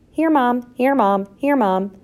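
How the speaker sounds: lightly voiced youngsters, one by one; children answering. A narrator's reading. Children answer roll call in turn.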